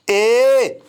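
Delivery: ए drawn out long and slow as a teaching demo; slow, exaggerated ए for students; long ए sound. Shown as a demonstration. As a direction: stretch ए like a long "ay". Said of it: "थोड़ा लंबा".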